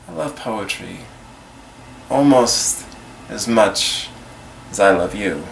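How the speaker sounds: soft, low confession with pauses; quiet, halting love confession; tender declaration. Acted. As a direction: say it softly, letting each phrase hang. Tender declaration within a poem.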